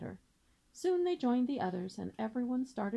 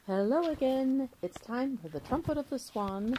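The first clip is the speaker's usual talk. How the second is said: ordinary delivery, nothing marked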